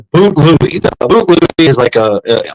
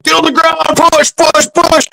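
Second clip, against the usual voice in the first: sergeants' drill commands. Retold or imitated. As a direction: imitate sergeants barking push-up orders, pitched way up. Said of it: "sergeants were like".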